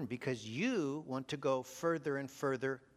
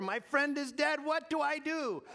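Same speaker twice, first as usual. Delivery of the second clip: panicked caller; 14 semitones higher, 5 dB louder than usual. Acted. Panicked hunter calls 911.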